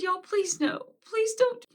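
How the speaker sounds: high, pleading begging. Retold or imitated. Begging the entities to spare her son, high desperate pleading. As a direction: beg them to stop. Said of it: "And I said".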